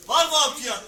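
loud calling for bids; auctioneer. Acted. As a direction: call out for a bid.

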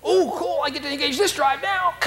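very high, delighted voice; gleeful dog. Pretend. Fight-driven dog thrilled by the correction, high and gleeful.

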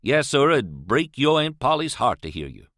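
ordinary delivery, nothing marked

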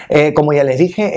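ordinary talk, nothing marked

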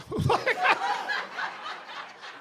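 laughter jumping way up in pitch; high cackle. Real, not acted. Comic laughing at his own punchline, high cackle. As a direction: let a high laugh burst out.

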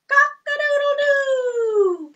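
high slow crowing; rooster crow. Pretend. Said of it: "What does a rooster say".

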